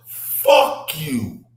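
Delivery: loud, high cursing outburst; angry shout. Real, not acted. Cursing the sheriff, loud angry outburst. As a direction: spit it at the sheriff.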